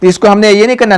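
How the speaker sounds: ordinary talk, nothing marked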